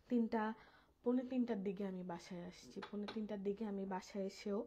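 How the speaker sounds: normal talking voice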